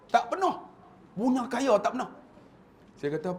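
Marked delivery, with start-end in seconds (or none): 0.1-2.1 much higher-pitched retort; mock incredulous denial. Re-enacting rich Pak Long never having gone, high comic voice. Acting out Pak Long's answer.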